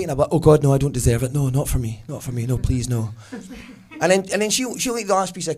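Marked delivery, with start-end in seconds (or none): 0.3-3.1 hammed-up self-denial, louder at first; mock martyr refusal. To girlfriend Marion offering cake, hammed-up martyr refusal.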